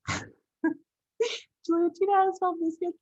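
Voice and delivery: high-pitched, through laughter; amused, squeaky-high laughing question. Real, not acted. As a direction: laugh helplessly while asking for help. Laughs at her own spelling lapse.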